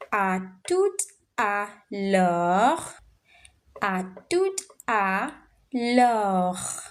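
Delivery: à tout à l'heure said slowly, syllable by syllable; slow demo. Shown as a demonstration. Teacher slowly models à tout à l'heure for students. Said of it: "you can say à tout à l'heure".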